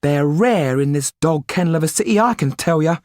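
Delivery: high put-on woman's voice; emphatic cafe woman. Cafe woman grumbles about the city, high and emphatic.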